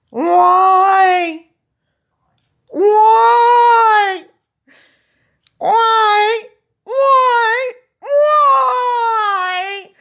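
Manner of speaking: high, loud, drawn-out cat yowl on each why. Retold or imitated. Cat in carrier wailing at owner on vet drive, loud high yowl. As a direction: wail like a cat stuck in a carrier. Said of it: "George is going, doing this".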